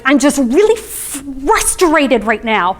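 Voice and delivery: put-on slow, exasperated child's voice, stretched out; a kid venting to a parent; frustrated six-year-old. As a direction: imitate a frustrated little girl, drawing out "frustrated". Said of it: "my six-year-old, came to me and she was like".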